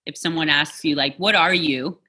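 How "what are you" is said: normal talking voice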